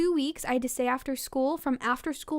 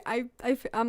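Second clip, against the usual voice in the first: ordinary delivery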